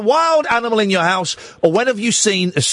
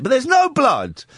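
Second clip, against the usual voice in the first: ordinary delivery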